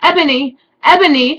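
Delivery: hammed-up calling Ebony; mock sad calling. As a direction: call after his girlfriend, hammed up. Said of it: "shouted Draco sadly".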